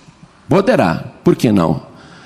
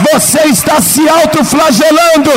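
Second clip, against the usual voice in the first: preaching at full force; 9 semitones higher than usual. Acted. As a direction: lift them up with a soaring cry. Preaching climax.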